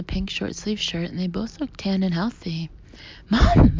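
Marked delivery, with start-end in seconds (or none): 3.3-3.8 high, loud shriek of joy. A narrator's reading. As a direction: shriek it across the street. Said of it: "I shriek".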